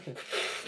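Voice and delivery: short imitated cat hiss. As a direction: hiss it without any voice. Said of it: "He hisses at you and goes".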